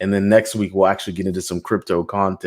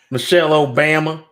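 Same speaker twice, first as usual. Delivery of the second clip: mock Texas accent; 5 semitones higher, 3 dB louder than usual. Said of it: "You're from Texas".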